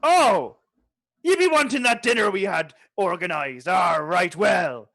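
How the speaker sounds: pirate voice slipping into Irish; pirate drifting into an Irish accent. Acted. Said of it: "my pirate voice is slipping through into Irish".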